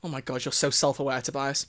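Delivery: ordinary delivery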